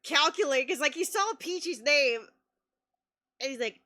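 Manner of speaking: ordinary talk, nothing marked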